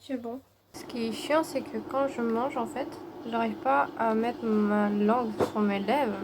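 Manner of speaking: tired, swollen-mouth mumble; after wisdom teeth. Real, not acted. Exhausted, in pain after surgery.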